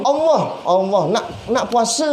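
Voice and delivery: high groan of feigned regret, falling away; mock dismay. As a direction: lament it like someone who just remembered. Acts dismay at forgotten Monday fast. (keluhan kesal yang dibuat-buat)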